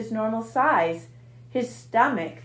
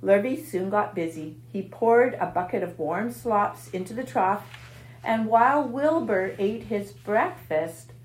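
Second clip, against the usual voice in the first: usual speaking voice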